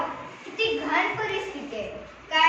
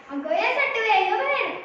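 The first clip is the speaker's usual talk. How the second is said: raised, higher scolding call; angry summons; 6 semitones higher, 5 dB louder than usual. Acted. Woman blaming her neighbour for the rubbish, angry call. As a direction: yell at the neighbour to come out. Quarrel over dumped rubbish.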